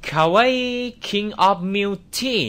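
Japanese accent. Shown as a demonstration. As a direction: stretch each word out in a cutesy Japanese accent. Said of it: "เราต้องออกเสียงแบบญี่ปุ่น". (สำเนียงญี่ปุ่นแบบล้อเลียน ช้ามาก เสียงสูงขึ้น)

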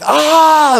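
high, loud cry of pain; retold scream. Pastor reliving breaking his ribs, sharp yelp. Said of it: "And I scream".

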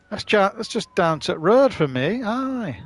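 put-on drawn-out regional accent, rising high on "Aye". Streamer joking about the road, in a put-on regional accent. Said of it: "I did that accent for".

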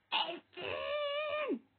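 high, loud wail of a name; exasperated cry. Real, not acted. Crying out at teammate. (isyanla yüksek sesle seslenme)

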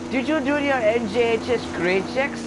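high, loud flustered teacher voice; a frazzled teacher shrieking at the class; hammed-up high teacher voice; Miss Morelli impression. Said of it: "the Miss Morelli voice?".

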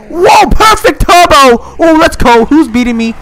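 high, loud cheering; excited shout. Real, not acted. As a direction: cheer a perfect launch. Perfect turbo in race.

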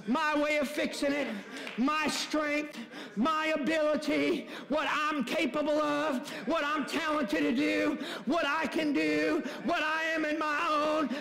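high, driving preacher's voice; full-force preaching. Acted. Pastor explaining the shoe to the church, fervent preaching. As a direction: hammer each phrase home to the crowd.

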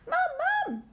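mildly voiced frightened piglet; terrified cry. A narrator's reading. Piglet waking from a nightmare, terrified. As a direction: call for mother in fear. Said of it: "shouted Babe in terror".

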